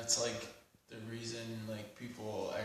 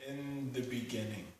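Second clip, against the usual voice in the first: measured spoken-word poem with long pauses; deliberate poetry reading, lines left to hang; poetry recitation; 6 dB louder than usual. Acted. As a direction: recite it solemnly, phrase by phrase.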